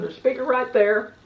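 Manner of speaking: ordinary talk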